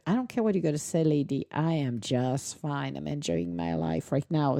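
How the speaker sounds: low, soft, lazy sleepy dog; mock dog voice. Sleeping dog answering his owner, low and lazy. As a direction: voice the dozing dog, drowsy and unbothered. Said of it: "And he said".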